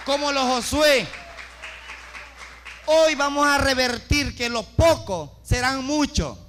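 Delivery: loud, building sermon climax; fervent loud preaching; preaching at full force. Acted. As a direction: proclaim it to the congregation, rising.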